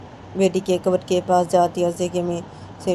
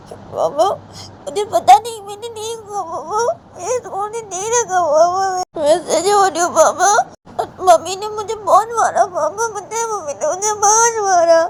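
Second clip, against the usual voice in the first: put-on high, sobbing child's pleading voice; a beaten girl crying to her papa; 13 semitones higher, 4 dB louder than usual. Crying daughter pleads to father.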